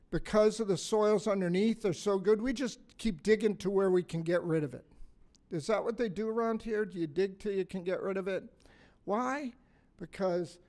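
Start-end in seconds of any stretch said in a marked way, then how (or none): none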